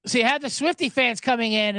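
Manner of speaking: ordinary talk, nothing marked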